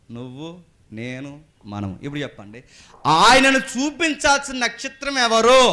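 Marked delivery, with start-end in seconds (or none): 3.1-5.6 high, slow, loud call to the congregation; loud preaching question. Real, not acted. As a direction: raise the voice and demand an answer slowly. (ఎత్తైన స్వరంతో నెమ్మదిగా గట్టిగా ప్రశ్న)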